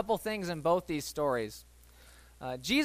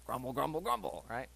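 softer, lower grumble grumble grumble; mock grumble; 5 semitones lower, 8 dB quieter than usual. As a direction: mutter it like a sulky crowd. Mimicking crowd grumbling.

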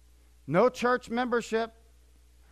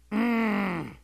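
displeased sigh. Shown as a demonstration. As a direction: let out a groan of displeasure. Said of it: "if I could put it into a sound".

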